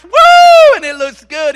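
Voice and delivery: very high, loud whoop; hammed-up squeal of delight; mock excited whoop. Preacher imitating a woman bragging about her new dress, loud whoop.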